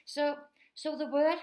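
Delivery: ordinary talk, nothing marked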